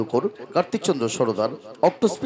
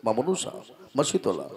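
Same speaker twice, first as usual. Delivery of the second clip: ordinary talk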